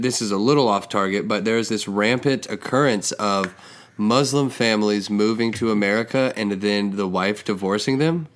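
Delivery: usual speaking voice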